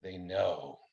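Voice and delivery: quiet, ominous drop in register; dropped register. A narrator's reading. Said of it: "Her voice drops to a lower register".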